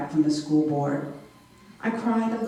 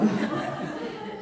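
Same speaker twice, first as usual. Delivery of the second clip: high squeal of a laugh; startled high burst of laughter; 5 dB quieter than usual. Real, not acted. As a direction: let a sudden high laugh escape. Laughing at memory.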